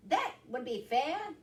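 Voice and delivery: high cartoon rabbit. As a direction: chime in as a storybook bunny. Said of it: "said Rabbit".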